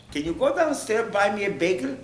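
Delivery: high, mock wheedling request; entitled request. Mocking people who ask favours.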